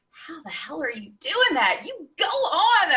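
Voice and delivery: high, loud amazed cheering; women at the studio cheered with a delighted laugh. Retold or imitated. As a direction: cheer them on in disbelief.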